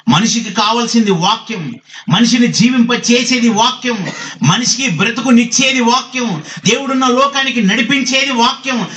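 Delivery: high-pitched, insistent preaching; forceful preaching. Real, not acted. Preacher tells the congregation what man needs, forceful preaching.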